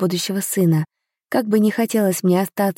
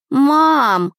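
put-on high, stretched childish protest; long, high plea to mum; drawn-out whine; 8 semitones higher, 4 dB louder than usual. A narrator's reading. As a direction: draw the word out like a boy objecting. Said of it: "протянул мальчик".

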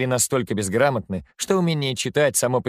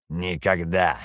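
low, gritted skull voice; through gritted teeth; 5 semitones lower, slower than usual. A narrator's reading. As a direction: squeeze it through clenched teeth. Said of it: "произносит череп сквозь зубы". (сквозь зубы)

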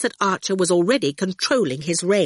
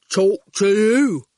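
slow, heavy, drawn-out words; terse and stubborn, stretching each word; blunt digger driver; 5 dB louder, slower than usual. A narrator's reading. Erskine's character voice.

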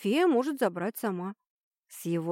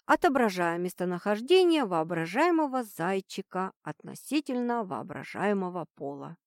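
usual speaking voice

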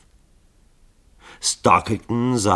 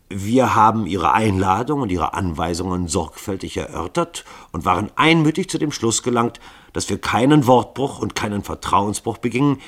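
firm argument; 6 semitones higher, 6 dB louder than usual. Acted. Assassins arguing logic with chief.

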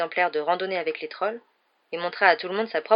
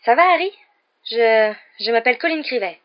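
high, loud, halting introduction; breathless; 4 semitones higher, 8 dB louder than usual. A narrator's reading. Boy introducing himself to Harry, breathless. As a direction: stammer out an eager, winded hello. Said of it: "dit-il le souffle court".

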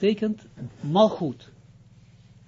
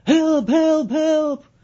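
very high, loud calls for help; retold yell for help, far higher and louder; drowning man's cry; 12 semitones higher, 8 dB louder, much slower than usual. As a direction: shout like a man in the water under the bridge. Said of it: "dan iemand die schreeuwt".

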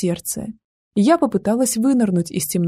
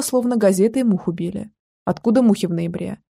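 ordinary delivery, nothing marked

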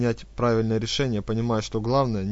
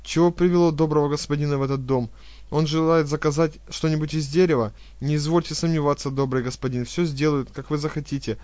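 fast, higher, louder babbling; rapid fawning chatter; 4 semitones higher, 5 dB louder than usual. Acted. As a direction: gabble it out, swallowing words. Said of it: "Быстро, глотая слова, затараторила".

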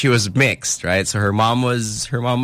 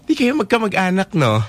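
high-pitched, lightly voiced elder lady; pry like a tactless auntie, pitched up; nosy auntie; 8 semitones higher than usual. Elder lady questioning the uncle about the child, nosy and high. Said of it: "And she goes".

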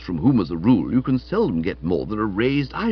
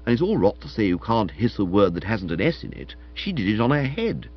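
ordinary delivery, nothing marked

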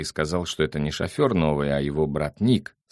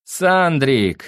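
high, loud, drawn-out call; 10 semitones higher, 7 dB louder than usual. A narrator's reading. A creature hails Sandro from the depths of the flat, calling out high. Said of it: "закричали из глубины кирпично-бетонного пространства".

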